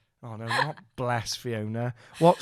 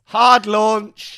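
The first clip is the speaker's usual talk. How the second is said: loud, high mock announcement; teasing loud callout; 8 semitones higher, 14 dB louder than usual. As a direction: blare it out to tease your co-host.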